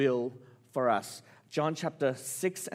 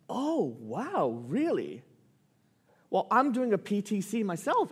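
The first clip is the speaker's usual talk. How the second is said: acted-out higher-pitched pleased amazement; delighted surprise; 6 semitones higher than usual. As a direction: play a boss pleasantly amazed, with a smile in the voice. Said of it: "with a big smile".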